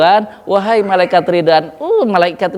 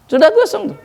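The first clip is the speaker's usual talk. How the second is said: high surprised exclaim. Acted. Exclaiming burnt body.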